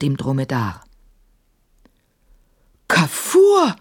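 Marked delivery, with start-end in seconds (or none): 2.9-3.7 very high exclamation, falling at the end; amazed high call on a drawn second syllable; astonished cry. A narrator's reading. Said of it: "rief Maimun und riss die Augen".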